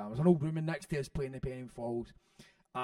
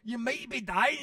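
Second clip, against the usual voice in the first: ordinary talk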